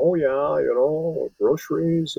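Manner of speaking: high-pitched mock Midwestern accent; singsong send-up of a Midwestern accent; broad Midwestern accent. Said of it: "the broad accents of the people".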